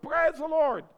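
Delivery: high, loud shouted praise; teacher voicing the shout he wants from believers. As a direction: shout it around the mosque. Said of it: "and shout".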